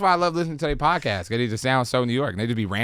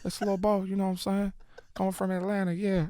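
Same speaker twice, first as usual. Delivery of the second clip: Atlanta accent; 7 semitones higher, 6 dB quieter than usual. Shown as a demonstration. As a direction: put on a small, high Atlanta voice. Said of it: "I can do a little Bolts accent".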